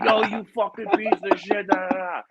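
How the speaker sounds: high mock yell; angry boss. The boss cursing him out, high mock yell. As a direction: snap at him, furious. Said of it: "he's like".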